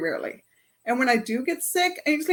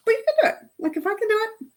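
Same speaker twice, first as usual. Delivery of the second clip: encouraging; 10 semitones higher, faster than usual. Real, not acted. Encouraging the class.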